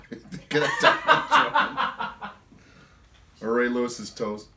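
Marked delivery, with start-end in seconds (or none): 0.0-2.3 slow, high, laughing through it; can barely get it out for laughing. Real, not acted. Host repeats question, then cracks up.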